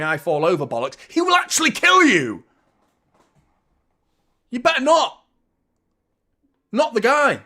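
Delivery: loud, high, swearing at the player; furious rant. Real, not acted. Angry fan yelling at a former player after the foul, furious. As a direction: shout abuse at the screen. Continues raging at Delap.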